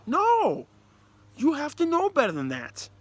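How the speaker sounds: high, loud flat refusal; loud incredulous no; emphatic no. Real, not acted. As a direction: shut the excuse down hard. Rejecting the offside excuse.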